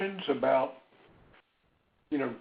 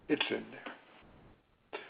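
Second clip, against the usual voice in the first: much softer and slow, almost to herself; soft slow repeat; 5 semitones lower, 14 dB quieter, much slower than usual. Real, not acted. Therapist reassuring a grieving caller, soft and slow. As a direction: let it sink in, hushed and slow.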